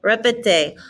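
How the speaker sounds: normal talking voice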